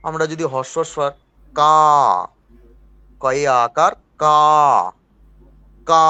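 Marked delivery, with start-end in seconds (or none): 3.2-6.1 কা with the vowel held long and slow; long vowel demo. Shown as a demonstration. Teacher demonstrates a long vowel to students, drawn out. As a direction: stretch the আ in কা. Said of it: "যেমন এটা দীর্ঘস্বর".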